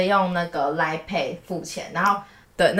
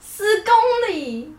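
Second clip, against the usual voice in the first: drawn-out, much higher shocked echo; disbelief; 13 semitones higher, much slower than usual. Real, not acted. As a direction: repeat it slowly in disbelief. Hearing the ten-kilometre suggestion. (难以置信地慢慢重复)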